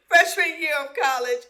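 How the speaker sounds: laughing. Real, not acted. Laughing remembering.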